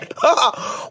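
quick, breathy burst of laughter; brief chuckle; short laugh. Real, not acted. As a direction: let out a short amused laugh. Amused by her own joke.

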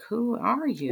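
retold bewilderment. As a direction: imitate someone not recognising a relative. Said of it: "she's looking at me like".

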